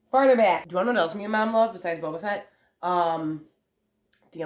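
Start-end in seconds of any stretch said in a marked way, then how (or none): 0.1-0.7 command to dog. Real, not acted. Ordering dog to back up.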